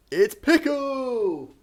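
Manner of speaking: loud, high, drawn-out cheer; excited announcement. Real, not acted. Streamer showing Pickle on camera to viewers, excited announcement. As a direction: stretch the name out high and loud, gliding down.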